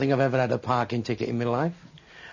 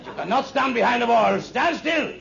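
high, loud order, pitched up; barked order; 10 semitones higher, 3 dB louder than usual. Acted. German officer ordering the prisoner to stand still, barked. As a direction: bark it like a firing-squad officer.

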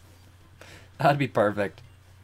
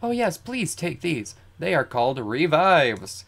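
put-on higher girl character voice; Lily, polite and helpful; 5 semitones higher than usual. Lily handing Blue some revives, helpful. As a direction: voice her helpfully, pitched up.

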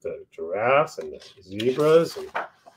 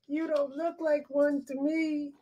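snooty doubter; 9 dB quieter than usual. Acted. Animal doubts Lulu.

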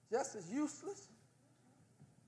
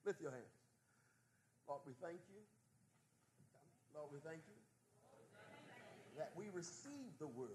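prayer. Acted. Preacher leading the congregation in prayer, hushed. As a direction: lead them gently into prayer.

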